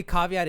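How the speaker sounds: caveat said with "ah" vowels, KAH-vee-aht; odd pronunciation. Real, not acted. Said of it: "I say things different".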